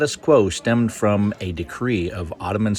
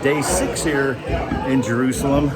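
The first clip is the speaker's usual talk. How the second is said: on a bumpy road; 5 semitones higher than usual. Real, not acted. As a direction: voice jolted by bumpy ride. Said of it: "Sorry about the shaking".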